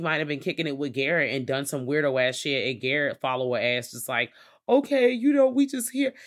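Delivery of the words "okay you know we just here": very high, loud put-on voice; mock clueless follower. Podcaster imitating Garrett following along, high clueless voice. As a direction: pitch it way up, blithely going along.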